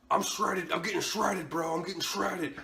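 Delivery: high, hyped mimicked bro voice; mock a show-off repeating his boast; boastful gym bro. Mimics guy boasting he is shredded.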